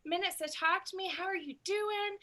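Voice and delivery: light friendly booking-call greeting; gentle warm thank-you to a customer on the phone. Shown as a demonstration. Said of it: "So, you know".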